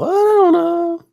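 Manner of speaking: very high, slow playing-dumb voice; mock-innocent high whine; mock Elliot. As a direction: squeak it out innocently. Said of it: "Elliot acts like he doesn't know".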